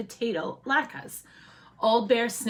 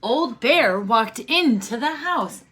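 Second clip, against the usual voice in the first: usual speaking voice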